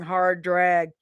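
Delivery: ordinary delivery, nothing marked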